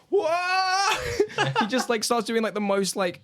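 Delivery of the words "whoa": startled whoa. Acted. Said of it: "and he just, like".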